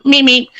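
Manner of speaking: high squeaky Roadrunner meep meep. Retold or imitated. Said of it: "It went".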